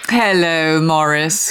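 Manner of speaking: loud call towards the speakerphone; loud pointed greeting. Acted. Wife greeting husband's agent on speakerphone, loud. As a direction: project the greeting across the room.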